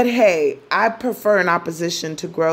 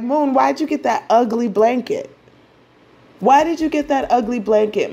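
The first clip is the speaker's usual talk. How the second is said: higher, mock scornful Venus; 6 semitones higher than usual. Venus mocks the moon's blanket in a higher mock-scornful voice. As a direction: tease the ugly blanket with a hammed-up sneer. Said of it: "Venus is like".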